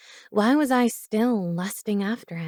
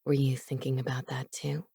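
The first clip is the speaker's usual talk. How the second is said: soft, breathy low whisper; seductive whisper; 6 semitones lower, 7 dB quieter than usual. A narrator's reading. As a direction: whisper it to tempt her. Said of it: "he inquired seductively".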